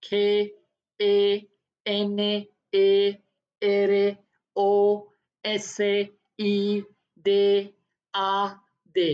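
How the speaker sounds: slow letter-by-letter spelling; dictating the letters slowly; spelled out slowly. Shown as a demonstration. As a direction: drag out each letter for the class. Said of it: "खेनोरिसीदाद".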